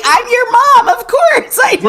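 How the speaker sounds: high, loud, dismissive mom voice; a loud, high mock mom scoffing at the news; sassy mom. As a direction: play the mom who already knew, brushing it off.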